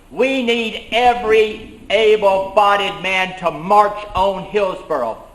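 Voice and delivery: loud, slow rallying cry; rallying call. Retold or imitated. A messenger calling men to march, loud and slow. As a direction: call every man out to march. Call to arms across county.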